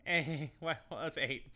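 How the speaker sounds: ordinary talk, nothing marked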